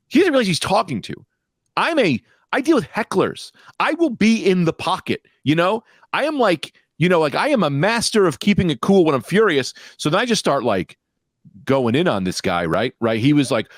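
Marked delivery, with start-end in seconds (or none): 0.1-9.7 worked up. Real, not acted. Heated retelling, rising pitch.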